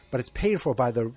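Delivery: ordinary delivery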